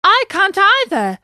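high loud wail; crying out. Acted. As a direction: cry it out in despair. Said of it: "cried Pinocchio".

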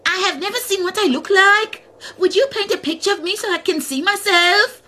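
high, loud pleading bird; the ostrich begs Tuka for a picture, pitched high. Acted. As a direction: ask eagerly in a high bird voice. Ostrich asks for portrait.

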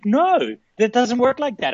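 high-pitched wife imitation; mock refusal. Imitating wife turning down makeup sex, high-pitched refusal.

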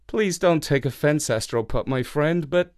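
ordinary delivery, nothing marked

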